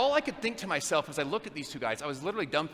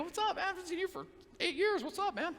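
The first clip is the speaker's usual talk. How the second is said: friendly greeting; 12 semitones higher, 5 dB quieter than usual. Retold or imitated. Greeting old acquaintance.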